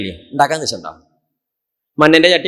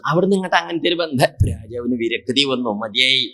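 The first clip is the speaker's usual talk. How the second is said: ordinary talk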